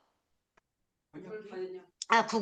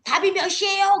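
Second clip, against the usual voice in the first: 큰 소리로; 6 semitones higher than usual. Retold or imitated. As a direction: call the question out to the learners. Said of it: "큰 소리로". (큰 소리로 묻듯이)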